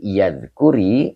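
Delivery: "yadhkuri" read slowly with a rising end. Shown as a demonstration. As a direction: repeat "yadhkuri" carefully for students. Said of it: "lam huruf nafi".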